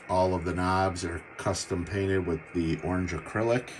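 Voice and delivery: usual speaking voice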